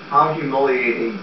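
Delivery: normal talking voice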